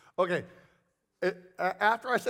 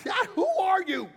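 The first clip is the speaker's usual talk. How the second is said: high, loud shout; indignant challenge; 13 semitones higher, 5 dB louder than usual. Retold or imitated. Old pastor challenging the unknown guest, indignant shout. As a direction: demand it loudly.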